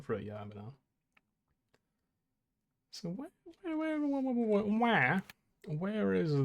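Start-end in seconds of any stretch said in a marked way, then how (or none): 2.9-6.4 high lilting repeated where, drifting down; sing-song searching. Real, not acted. Searching the map, a high sing-song where. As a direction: call out where like a little tune.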